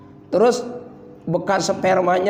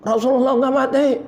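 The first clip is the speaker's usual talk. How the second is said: quick raised mock outburst; Umar grieving; 6 semitones higher than usual. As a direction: Umar refusing to accept it, fast. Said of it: "Sambil kucur air mata". (Umar marah sambil menangis)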